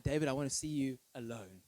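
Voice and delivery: ordinary delivery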